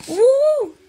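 high, loud whoop of joy; excited cheer. Real, not acted. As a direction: let out a happy cheer. Cheering at a game moment.

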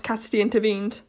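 usual speaking voice

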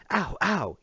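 high, loud pained yelp; yelled. Acted. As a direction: cry out as your hair is pulled. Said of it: "yelled Matt".

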